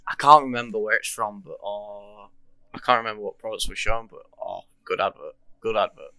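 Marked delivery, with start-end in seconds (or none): none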